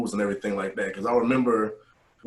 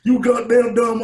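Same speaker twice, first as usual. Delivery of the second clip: loud, high barking yell at recruits; drill instructor yell; 12 semitones higher, 7 dB louder than usual. Retold or imitated. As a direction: scream it like an angry drill sergeant.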